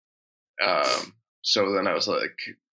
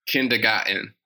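Rhode Island accent. Shown as a demonstration. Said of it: "you wanna hear how they say kindergarten".

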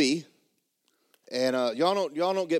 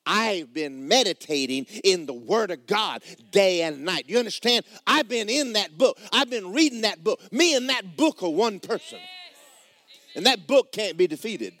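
higher, louder preaching, rising toward its peak; full preaching; 4 semitones higher, 3 dB louder than usual. Real, not acted. Preacher testifying to the congregation about the book, building. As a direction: testify it to the room. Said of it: "I'm preaching pretty good".

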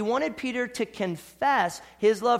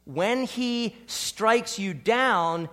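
slow emphasis; 3 semitones higher, much slower than usual. Acted. Preaching God's discipline.

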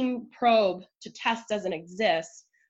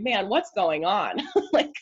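laughing; 4 semitones higher than usual. Real, not acted. Laughing at the roller coaster.

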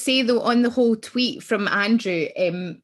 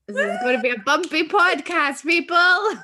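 higher and louder, hamming up podcast; louder playful twist; 6 semitones higher, 5 dB louder than usual. Retold or imitated. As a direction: swell into podcast, people with a grin. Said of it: "the Bette Davis line".

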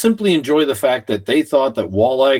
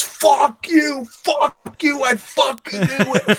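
high, laughing shouted curses, much higher; furious yell; 12 semitones higher, slower than usual. Retold or imitated. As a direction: rage at the cheater, half laughing. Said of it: "And he goes".

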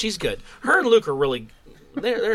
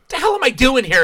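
exasperated quote; 7 semitones higher, 5 dB louder than usual. Retold or imitated. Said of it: "she's like".

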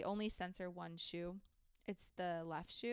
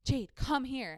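pressing call to come over, a bit louder; urgent summons; 4 semitones higher, 7 dB louder, slower than usual. Retold or imitated. The cashier calls her manager over a second time, urgent. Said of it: "And she's like".

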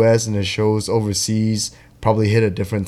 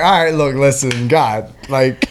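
mock plea to God; 7 semitones higher, 3 dB louder than usual. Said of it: "It's like".

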